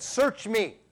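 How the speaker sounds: David's plea. Retold or imitated. Preacher voicing David's searching plea, lightly pleading and high. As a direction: ask earnestly like an archer seeking help. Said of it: "like David said".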